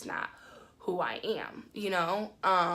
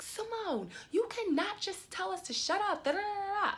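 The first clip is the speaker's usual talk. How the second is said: high-pitched mimicry of a complaining friend; mocking imitation; 11 semitones higher than usual. Imitating the offended front-seat passenger, mocking high protest. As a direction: mimic her protest high up, running into babble.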